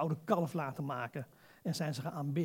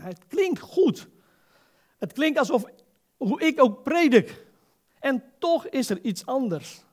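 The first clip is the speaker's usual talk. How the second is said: impressed enthusiasm; 8 semitones higher, 9 dB louder, slower than usual. Retold or imitated. Preacher voices his impressed reaction.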